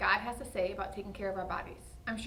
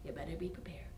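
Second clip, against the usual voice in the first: whisper; 5 semitones lower, 10 dB quieter than usual. Acted. Host whispers ominous warning.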